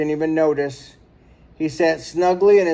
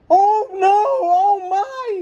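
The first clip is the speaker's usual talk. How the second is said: much higher, crying wail; 3 dB louder, much slower than usual. A narrator's reading. Mister Merriweather wails in fright when woken in midair, much higher. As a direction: wail in panic as the house shakes. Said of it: "he wailed".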